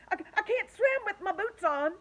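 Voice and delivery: high, loud stammering panic; panicked. Acted. The centipede pleads with the others, high and panicked. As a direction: panic over the boots.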